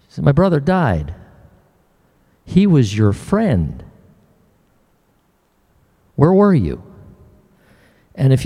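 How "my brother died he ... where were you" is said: Mary's pained reproach, mildly acted; agonized questioning. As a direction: question him with restrained agony. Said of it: "can you feel the agony in her voice?".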